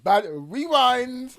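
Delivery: high, loud, drawn-out call; mock rewind call. Podcast host teasing his laughing co-host, mock rewind call. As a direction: stretch it out like a DJ calling a rewind.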